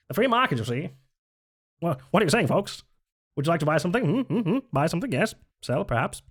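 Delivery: high, slick pitchman voice; fifties salesman voice. Pretend. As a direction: sell it like a fifties ad man. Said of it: "Like a fifties salesman voice".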